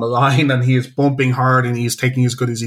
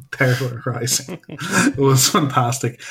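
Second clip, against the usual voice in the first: amused repeat; slower than usual. Real, not acted. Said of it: "gotta get that one in".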